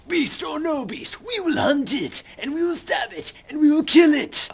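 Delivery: high, loud, hammed-up battle speech; rallying war cry. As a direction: rouse the hunters with mock-fierce yells. Jack's rallying war speech, acted.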